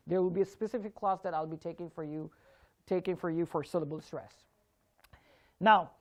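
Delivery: normal talking voice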